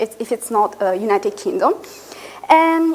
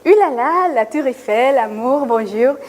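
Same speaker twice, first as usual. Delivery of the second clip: lilting, teasing French-cliché imitation; mock French clichés. Family and friends reacting to the move to France, mock French clichés. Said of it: "everyone was like".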